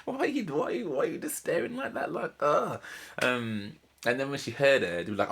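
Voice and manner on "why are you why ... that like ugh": high, put-on disbelieving voice; mock incredulous. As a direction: mimic someone baffled and judgy. Mocking Charlie's blank stare.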